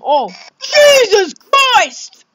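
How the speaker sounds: loud, high, drawn-out exclamation; real startled yell; shocked outcry. Real, not acted. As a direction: cry out in shock, stretching both words. Startled outburst at game event.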